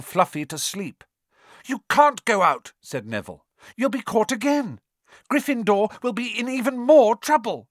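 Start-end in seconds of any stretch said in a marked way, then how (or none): 1.6-7.7 Neville pleading. A narrator's reading. Neville blocks them.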